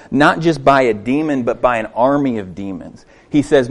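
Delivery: normal talking voice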